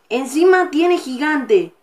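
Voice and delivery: loud complaint about the opponent's giant; exasperated disbelief. Real, not acted. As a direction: hit encima hard, outraged. Repeats louder in disbelief.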